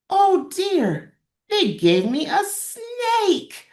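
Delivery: high, slow cry of dismay; squeaky dismayed voice. Acted. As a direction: wail it like a shocked little kid. Character's dismay in read story.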